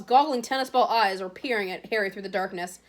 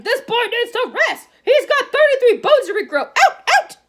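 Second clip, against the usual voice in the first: shrill furious shout, much higher and louder; 14 semitones higher, 10 dB louder than usual. A narrator's reading. Matron throwing the team out of the ward, shouting. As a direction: chase them all out at the top of her voice.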